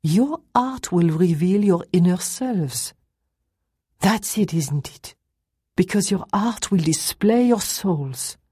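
slow, emphatic pronouncement as Madame; Madame, declaiming. Acted. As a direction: declare it deliberately, stretching the key words. Madame declaims her answer.

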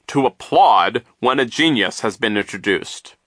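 slow, loud, pompous insistence; stern. A narrator's reading. Self-declared genius demanding applause from children, stern. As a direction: spell it out sternly, slowly. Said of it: "he said sternly".

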